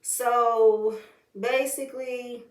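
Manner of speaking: ordinary talk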